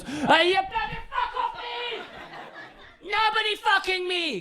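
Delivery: high-pitched mock outrage; mock furious. Catfished man messaging the comedian, high mock fury. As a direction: squeal it in comic fury. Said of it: "He's angry. He sends me a furious message".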